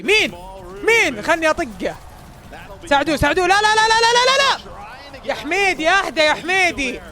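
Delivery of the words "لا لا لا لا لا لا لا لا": high, loud panicked protest; frantic no no. Real, not acted. Streamer panicking as his team loses the fight, high frantic cries. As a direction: beg the play not to go wrong. Teammate about to die.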